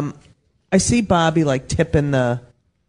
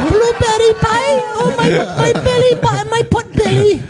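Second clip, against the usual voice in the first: French woman from Pulp Fiction. Acted. Comic imitating French broad from Pulp Fiction, high-pitched mock accent. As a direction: squeak it as a hammed-up French movie girlfriend. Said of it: "French broad from Pulp Fiction".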